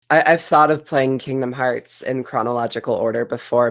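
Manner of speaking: normal talking voice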